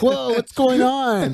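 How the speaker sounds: high, slow put-on voice; mock bewilderment. Teasing a friend who rubbed his eyes, high mock bewilderment. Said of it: "He's like".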